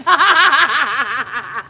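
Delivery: loud laugh breaking in; burst of laughter. Real, not acted. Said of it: "I needed that giggle".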